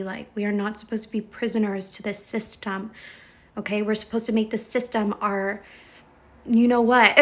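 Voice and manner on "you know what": amused outburst. Real, not acted. Laughing aside mid pep talk.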